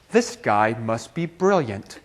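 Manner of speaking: low, slow, softened voice; awed thought. Retold or imitated. Classmates marvel at first student done, awed. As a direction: drop low and slow, as in hushed admiration.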